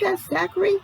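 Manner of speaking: ordinary delivery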